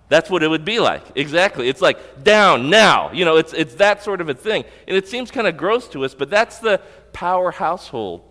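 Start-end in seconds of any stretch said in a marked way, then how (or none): 2.2-3.1 very loud, forceful order, pitched up; emphatic command. Acted. Potiphar's wife ordering Joseph down, emphatic command. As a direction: fire off two words as an in-your-face demand. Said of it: "these two words of emphatic command would be sort of like".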